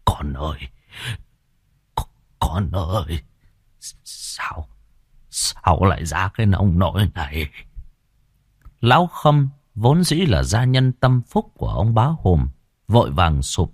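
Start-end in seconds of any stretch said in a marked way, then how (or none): 0.0-7.5 broken, whispered stammer of a father; a stunned father stammering over his son; stammering in shock. Acted. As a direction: gasp out the words in horror. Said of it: "lắp bắp".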